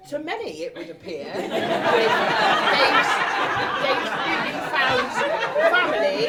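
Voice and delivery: words broken up by laughter; laughing through it. Real, not acted. Laughing through the wedding speech to the couple. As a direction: push through the giggles.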